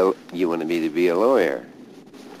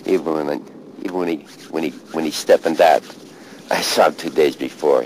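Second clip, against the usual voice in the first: raw grief, louder; 7 dB louder, faster than usual. Real, not acted. Grieving recollection at grave.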